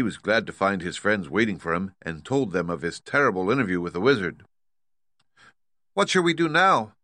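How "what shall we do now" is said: put-on high, plaintive girl's question; sad. A narrator's reading. Dorothy asking her friends after bad news, sad and high. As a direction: ask it sadly in a raised voice.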